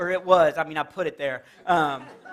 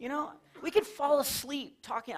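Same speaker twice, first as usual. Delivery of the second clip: ordinary talk, nothing marked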